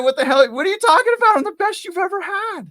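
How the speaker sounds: very high, loud indignant squeal; outraged disbelief. Retold or imitated. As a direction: squeak in shocked outrage at being fired. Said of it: "I was like".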